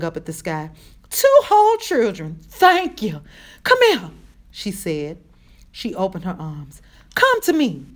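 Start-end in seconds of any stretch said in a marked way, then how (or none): 1.1-4.1 loud, high, fervent exclaiming; overjoyed relief. A narrator's reading. Relieved woman finds children alive. 7.1-7.9 high, loud, relieved call; emotional beckoning. A narrator's reading. Woman calls children into arms.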